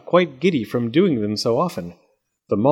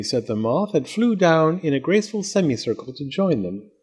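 plain reading voice

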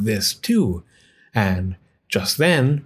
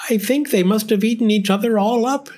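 put-on higher old woman voice; fretful old woman, pitched up; 10 semitones higher than usual. A narrator's reading. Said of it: "said the very old woman".

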